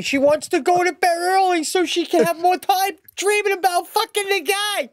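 spiral into jealous outrage; outraged rant. Real, not acted. Riffing to his co-host about the jealousy, very high.

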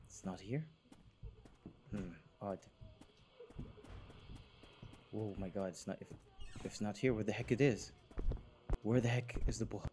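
whispering. Real, not acted. Searching quietly near the monster.

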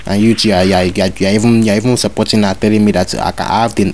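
normal talking voice

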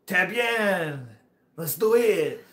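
put-on high, loud, drawn-out French accent; hammed-up French-accented enthusiasm. French rider agreeing to the trail, imitated French accent. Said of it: "But he was like".

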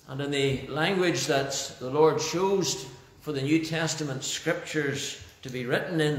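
ordinary talk, nothing marked

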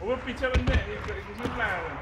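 loud callout. Real, not acted. Warns about enemies behind.

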